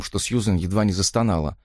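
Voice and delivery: usual speaking voice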